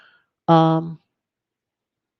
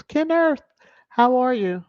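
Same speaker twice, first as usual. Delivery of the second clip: high sing-song greeting; 9 semitones higher than usual. Real, not acted. Greeting a chat viewer by name.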